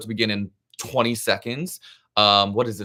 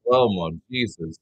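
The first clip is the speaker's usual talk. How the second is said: ordinary delivery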